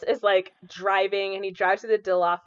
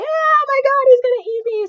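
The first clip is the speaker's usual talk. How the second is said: much higher and louder frightened cry; mock panic; 9 dB louder than usual. Ian fleeing the T-Rex in the car, hammed-up panic. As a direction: shriek in hammed-up terror. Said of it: "and he's like".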